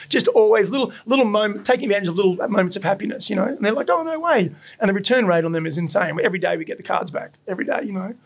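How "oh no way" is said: high amazed oh no way; imitating a surprised customer; mock amazement. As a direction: voice jumps up in delighted surprise. Customers amazed at free burger.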